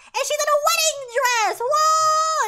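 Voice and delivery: high, loud, hammed-up wonder; amazed announcement. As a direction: build to a big long whoa. Announces the bride's surprise return.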